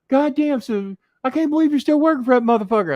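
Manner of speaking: hammed-up indignant Jimmy impression; imitating Jimmy Cornette. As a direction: squawk it like an outraged Jimmy. Jimmy voiced in angry call.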